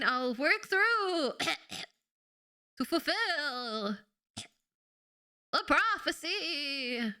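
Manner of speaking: slow, coughing dying prophet; overacted death scene. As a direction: force words out through coughs. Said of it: "spice stuck in my throat".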